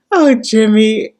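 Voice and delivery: playful caught-out teasing; mock teasing smirk. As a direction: drawl out his name like a busted flirt. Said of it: "he starts smirking like".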